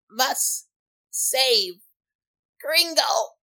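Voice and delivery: high, halting gnome plea; gasping gnome. Acted. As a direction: force each word out, fading. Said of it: "and he goes".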